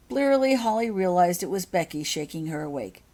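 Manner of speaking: plain reading voice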